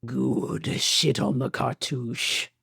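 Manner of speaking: slow, altered sinister Emperor rasp. Acted. Host reading patron names in Emperor voice. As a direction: intone it like a Sith lord. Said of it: "Let's do it in Emperor voice".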